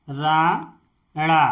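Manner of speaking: Rana drawn out very slowly, each sound clear; Rana stretched syllable by syllable; slow spelled-out word. Shown as a demonstration. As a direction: say Rana slowly for a child to copy. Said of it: "जैसे इस ड़, इसको पढ़ते हैं ड़".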